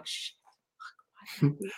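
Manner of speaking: a hushed, frustrated self-scolding; muttered self-curse. Acted. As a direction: hiss it under your breath, annoyed with yourself. Voicing self-blame.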